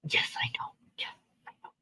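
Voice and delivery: hushed breathy whisper. Real, not acted. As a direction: whisper so nobody wakes. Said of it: "whispering in the morning".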